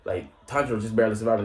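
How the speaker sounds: normal talking voice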